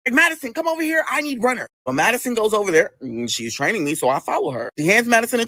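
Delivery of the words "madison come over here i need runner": lightly voiced higher call across a kitchen; imitated calling out. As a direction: call her over in a higher mock voice. Imitated coworker calling for runner.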